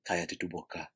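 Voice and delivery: very soft, low Spanish hush; close-mic shushing. Shown as a demonstration. Host going right up to a talker, hushed. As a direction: murmur it right into the microphone. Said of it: "I get close to the microphone".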